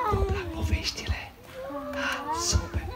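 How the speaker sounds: put-on whispered question to the kids; hushed whisper to the children. Shown as a demonstration. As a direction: breathe it with no voice. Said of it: "Și puteți doar în șoaptă?".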